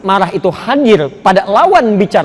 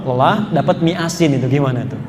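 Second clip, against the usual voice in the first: ordinary talk, nothing marked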